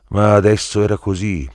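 "ma adesso era così" detto più forte e più acuto; enfatico. Acted. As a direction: martella la frase con forza. Ripresa enfatica della stessa frase. (affermazione forte e più acuta)

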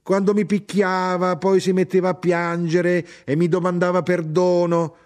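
high, loud, sustained complaint; indignant cry. A narrator's reading. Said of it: "gridò mia madre".

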